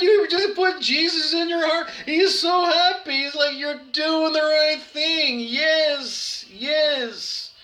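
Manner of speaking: high, ecstatic preacher voice; preacher of Penticton. Retold or imitated. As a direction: gush 'yes, yes' like an elated preacher. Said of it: "He said".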